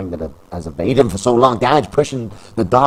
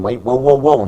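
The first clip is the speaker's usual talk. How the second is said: surprised; 4 semitones higher, 6 dB louder than usual. Acted. News in his earpiece.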